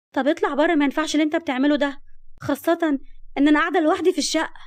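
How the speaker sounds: high, loud urgent demand; agitated protest. Acted. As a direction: insist he leave, flustered at being alone. Orders intruder out of flat.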